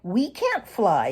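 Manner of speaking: grumbled. A narrator's reading. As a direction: whine it like a thwarted pup. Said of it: "he grumbled".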